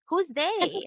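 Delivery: incredulous repeat. Retold or imitated. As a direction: throw it back in disbelief.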